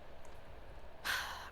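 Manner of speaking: long, quiet exhale before speaking; heavy sigh. Acted. Blogger about to address Kalishka personally, long quiet sigh. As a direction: let out a soft, drawn-out sigh. Character readies personal message.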